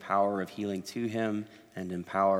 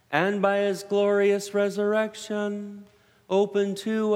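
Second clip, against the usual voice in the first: slow, solemn, lifted prayer voice; formal liturgical prayer; 13 semitones higher, 7 dB louder, much slower than usual. Acted. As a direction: proclaim it like a solemn priest. Presider prays the eucharistic preface.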